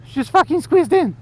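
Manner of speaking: high, loud furious outburst; angry shout. Real, not acted. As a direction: blow up at the driver. Driver squeezes in front.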